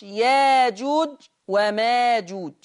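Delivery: ياجوج وماجوج بألف مكان الهمزة، ببطء; انطق ياجوج بالألف; ياجوج وماجوج بلا همز. Shown as a demonstration. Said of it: "فيقرأهما ورش". (ياجوج وماجوج بالإبدال)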